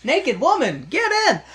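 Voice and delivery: hammed-up cabbie yelling from the car window; taxi driver calling out. Taxi driver calls to naked Sheila.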